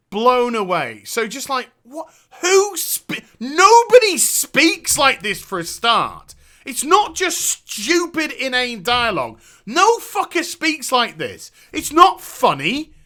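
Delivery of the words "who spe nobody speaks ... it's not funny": higher, louder, slow incredulous rant; exasperated rant. Real, not acted. Streamer ranting at the game's dialogue, exasperated and loud. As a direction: vent your disbelief at awful writing.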